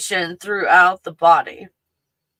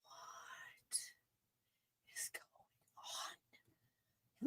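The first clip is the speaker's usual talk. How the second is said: a slow, breathless, much softer whisper; mock whisper; 29 dB quieter, much slower than usual. Imitating her own dazed reaction, whispered. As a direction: whisper it like you're spooked. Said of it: "I'm like".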